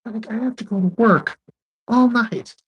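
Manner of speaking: high, loud mock whine; hammed-up high-pitched grievance; imitated complaint. Voicing weary Whitney's complaint.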